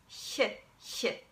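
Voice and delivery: soft ch hissed at the hard palate; soft hissing German ch; German ich sound. Shown as a demonstration. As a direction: hiss the ch like the start of huge. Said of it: "Another sound you have to learn to make is".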